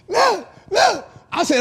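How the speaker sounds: high shrieking laugh into look, look; loud laughter. Real, not acted. Recalling the family at the table, loud high laughter. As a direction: crack up loudly, then squeak out the words.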